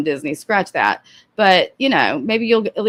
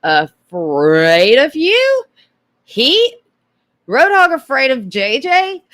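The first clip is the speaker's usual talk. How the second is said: incredulous; 5 semitones higher, 5 dB louder, much slower than usual. Real, not acted. Incredulous slow question.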